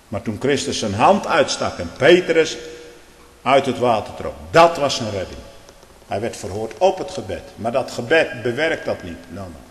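usual speaking voice

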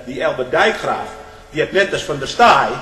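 usual speaking voice

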